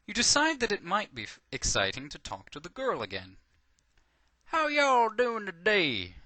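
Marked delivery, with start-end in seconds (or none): 4.5-6.2 loud, high, slow southern drawl; southern accent. A narrator's reading. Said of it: "you ask her in a southern accent".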